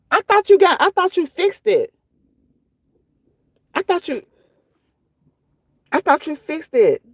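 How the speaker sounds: high, incredulous protest; stunned, with halting restarts; dismayed disbelief. Real, not acted. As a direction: say it in baffled dismay, pitch up high. Dismayed that problem persists.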